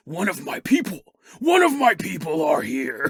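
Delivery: building to a wrestler shout. Acted. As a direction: bellow it like a pro wrestler. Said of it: "he's a professional wrestler now".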